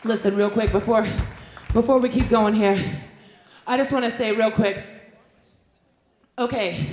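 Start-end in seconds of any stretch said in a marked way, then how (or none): none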